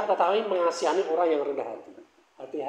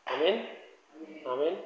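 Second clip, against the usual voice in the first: ordinary delivery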